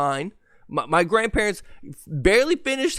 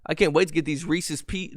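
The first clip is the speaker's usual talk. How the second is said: ordinary talk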